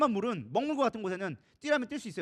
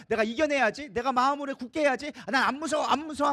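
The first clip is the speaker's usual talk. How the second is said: loud, raised pep talk to oneself; forced self-encouragement; 5 semitones higher, 5 dB louder than usual. Acted. Someone willing away their own fear, forced bravado. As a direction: psych yourself up, insistently.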